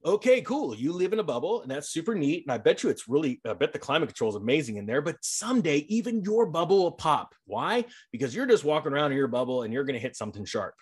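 normal talking voice

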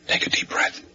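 tense whisper. Acted. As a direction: whisper before the rush. Before bursting in.

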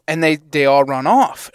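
ordinary talk

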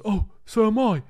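mock posh train enthusiast, high and bright; imitating Francis. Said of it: "And he goes".

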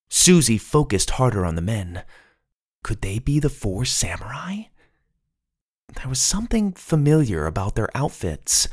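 usual speaking voice

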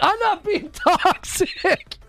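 high, slow, laughing through the words; laughing denial. Real, not acted. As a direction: deny it while cracking up. Denies being toxic while laughing.

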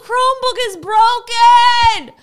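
put-on high, slow, squeaky whine of a kid; mock kid voice. Hosts mocking the kid's broken Chromebook, squeaky whine. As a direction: whine it like a helpless child.

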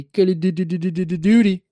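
tripping over the word; stuttering. Real, not acted. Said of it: "I can't say it".

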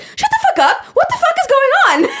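very high, mock-shouted disbelief; imitated shocked outburst. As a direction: act out a friend freaking out, high-pitched. Acts friend reacting behind the camera.

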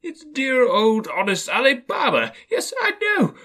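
high, loud, overflowing excitement; ecstatic, between laughing and crying. A narrator's reading. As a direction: relive a beloved storybook with childlike wonder. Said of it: "extraordinary voice between laughing and crying".

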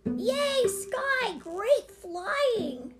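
put-on very high child's cheering; gleeful cheer. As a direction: cheer a rescuer like a thrilled kid. Alex cheers the rescue.

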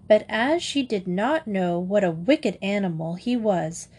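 ordinary delivery

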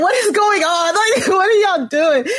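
high, laughing disbelief; mock bafflement. Replying to the Southern cousins, high amused bafflement.

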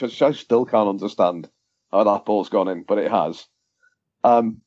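normal talking voice

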